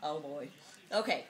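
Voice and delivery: normal talking voice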